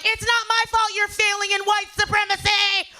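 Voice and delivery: high, laughing mock retort; sassy comeback. Comic retorts to the lady in line, sassy. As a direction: fire the zinger back. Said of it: "I'm a quick wit".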